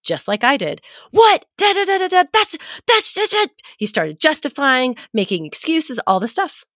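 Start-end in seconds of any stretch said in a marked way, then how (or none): 1.1-3.5 high-pitched mock rant; retold flipped-lid outburst. Mother imitating her son flipping his lid, mock outburst. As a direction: sputter an imitated angry outburst, much higher. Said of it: "He flipped his lid".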